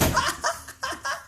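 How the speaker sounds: a sudden high laugh from Rabbi Akiva; high burst of laughter. Acted. Telling children how Rabbi Akiva laughs at the ruins, high laugh. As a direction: let out one high, bright laugh. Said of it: "he starts to laugh and he goes".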